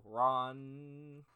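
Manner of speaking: ordinary talk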